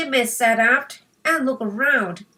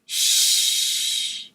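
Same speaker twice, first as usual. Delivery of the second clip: a breathy shush at the dog; boy hushing the dog while reading; hushing shh. Acted. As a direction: shush him without looking up. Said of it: "grunted Jimmy".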